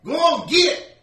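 loud high shout to scare it off; villager yelling. Acted. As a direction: bark it like a scared farmer. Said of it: "he goes".

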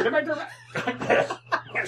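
high, giggling through the words; laughing. Real, not acted. Player giggling as the party turns around, laughing.

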